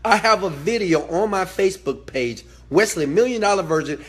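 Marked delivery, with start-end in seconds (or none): none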